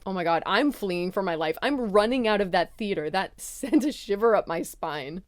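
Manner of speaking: ordinary delivery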